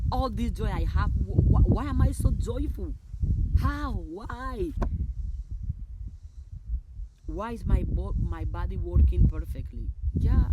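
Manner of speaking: exultant, stumbling excitement; overjoyed. Real, not acted. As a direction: burst with joy, nearly shouting. Overjoyed affirmations after laughing.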